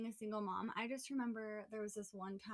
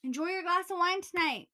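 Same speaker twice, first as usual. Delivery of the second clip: heated rant; 7 semitones higher, 12 dB louder than usual. Real, not acted. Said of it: "Pisses me off".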